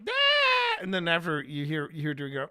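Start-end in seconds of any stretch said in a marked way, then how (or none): 0.1-0.8 sudden squeaky shriek; high startled cry; high yelp. Retold or imitated. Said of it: "when Vi goes".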